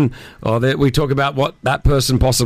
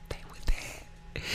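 breathy secret whisper; whispering on the phone; 17 dB quieter, slower than usual. Real, not acted. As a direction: drop to a hush. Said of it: "Why are you whispering?".

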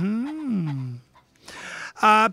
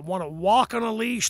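ordinary delivery

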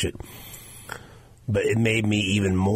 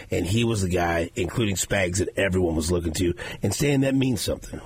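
normal talking voice

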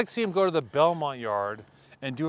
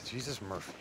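soft echoed whisper; 6 semitones lower, 13 dB quieter than usual. Real, not acted. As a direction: murmur it back, hushed. Echoing the whisper.

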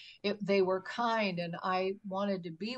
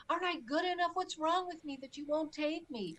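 retold scream; 7 semitones higher than usual. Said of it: "I even screamed out".

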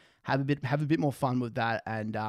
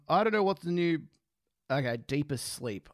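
ordinary delivery

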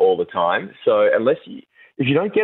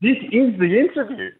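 much higher, laughing through it; laughing protest; 13 semitones higher than usual. Retold or imitated. Host retelling his reply to the producer, laughing high. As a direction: insist through giggles. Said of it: "And I'm like".

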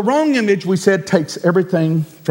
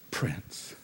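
whispered; 20 dB quieter than usual. Real, not acted. Hushed reverent ending.

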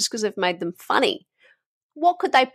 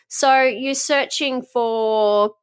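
ordinary talk, nothing marked

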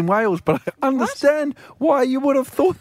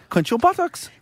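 New Zealand accent; 7 semitones higher than usual. Shown as a demonstration. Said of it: "Do you wanna try that?".

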